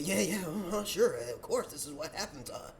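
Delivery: low, soft, mumbled agreement; dropped-down, yes-yes mimicry; mock agreement. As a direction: imitate someone nodding along. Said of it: "as you nodding your head".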